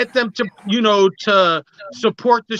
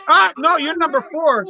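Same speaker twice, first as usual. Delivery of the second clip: high-pitched startled refusal; mock alarmed rejection; 8 semitones higher than usual. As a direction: recoil in comic alarm, pitch jumping up. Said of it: "I'm like".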